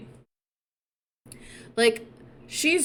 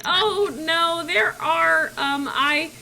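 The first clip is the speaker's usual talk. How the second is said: high, slow, hesitant excuse; acted awkward stalling; 6 semitones higher, 4 dB louder, much slower than usual. Mulan stalls before confessing, high and awkward. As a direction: drag it out, searching for words.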